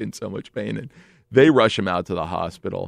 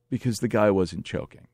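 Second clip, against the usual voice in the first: plain reading voice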